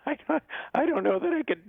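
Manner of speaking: lightly held high Shaggy voice. Pretend. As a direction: stay up in a cartoon Shaggy register. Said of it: "I haven't done that voice".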